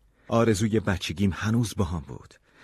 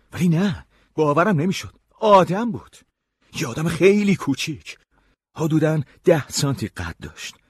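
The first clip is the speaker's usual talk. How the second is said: higher, louder astonished narration; amazed disbelief; 9 semitones higher, 5 dB louder than usual. A narrator's reading. Castaway finds a tiny person climbing his leg, amazed disbelief. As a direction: tell it with rising amazement.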